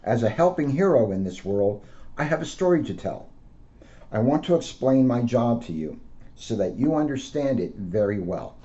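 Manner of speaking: gentle storybook read-aloud; a police officer reading to children. Acted. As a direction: read it out to kids like a picture book. Officer reads picture book aloud.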